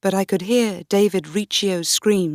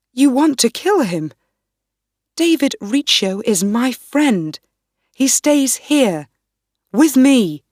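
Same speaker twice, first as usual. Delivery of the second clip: higher, louder, furious refusal; angry defiance; 5 semitones higher, 5 dB louder than usual. Acted. The queen shouting back at Ruthven, angry refusal. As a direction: shout him down to save your friend. Queen defies armed lord.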